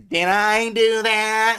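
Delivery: high, slow nasal-free Urkel squeal of guilt; Urkel impression. Mimicking the sitcom nerd to his co-host, slow and high. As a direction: play the sheepish nerd caught out. Urkel catchphrase in a podcast bit.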